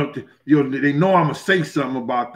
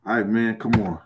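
ordinary talk, nothing marked

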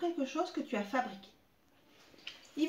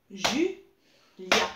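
Julia split into beats, louder, Ju-lia; syllable by syllable; 3 semitones lower, 6 dB louder than usual. Shown as a demonstration. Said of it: "Deux syllabes". (Julia scandé, plus fort)

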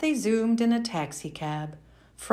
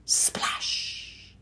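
ordinary delivery

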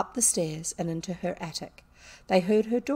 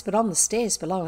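ordinary delivery, nothing marked

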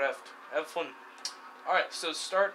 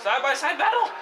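high, loud excited call; hyped callout; 9 semitones higher, 6 dB louder than usual. Real, not acted. Gamer hypes a wheel-to-wheel fight in the race. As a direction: call the battle like it matters.

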